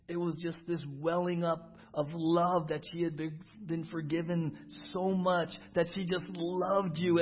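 loud, high, slow swelling prayer about love; preaching at full force. Real, not acted. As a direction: build the prayer into a fervent climax. Impassioned prayer builds.

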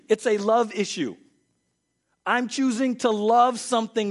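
preaching at full force. Acted. Pastor driving home the love issue, full-force preaching. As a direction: hammer each line home to the congregation.

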